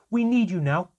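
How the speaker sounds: loud, high-pitched angry yell; child's enraged outburst at a parent; furious shouting. Acted. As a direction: shout it like a blow landing. Child attacking father, shouting.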